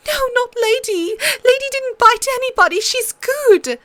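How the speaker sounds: put-on a girl begging for her pet; girl pleading to save her wolf; desperate girl. A narrator's reading. Sansa pleading.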